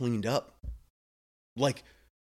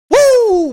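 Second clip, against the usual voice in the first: loud, high-pitched cheer; soaring whoop of celebration; celebratory whoop; 14 dB louder than usual. Acted. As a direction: let out a big "woo" of delight. Cheering the patient in retold scene.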